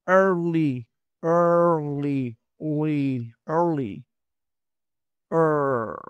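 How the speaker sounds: "early" with a strong r-colored er; r vowel demo. Shown as a demonstration. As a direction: curl the r in "early". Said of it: "Entonces sería er". ("early" con "er" americano)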